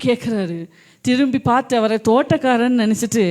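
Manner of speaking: normal talking voice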